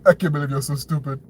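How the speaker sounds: quick, clumsy attempt at a Trump voice; bad Trump accent. Commentator mocking the president gloating, badly faked Trump accent. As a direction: have a failed go at a Trump accent. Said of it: "I don't know how to do a Trump accent".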